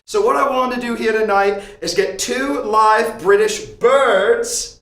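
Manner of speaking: put-on American doing a light British accent. Host on his British-themed stream, lightly put-on British accent. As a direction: keep up a mild British accent all night. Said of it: "I will not stop doing a British accent".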